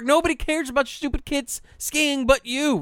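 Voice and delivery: high-pitched indignant tirade; retold exasperated scream. Skier wants to scream at the filming mother, exasperated. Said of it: "I wanna scream at her".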